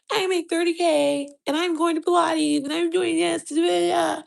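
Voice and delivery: put-on prance it out like a showoff girlfriend; bragging girl impression. Mocking other women bragging about their thirty K, high and showy. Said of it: "all these bitches was saying".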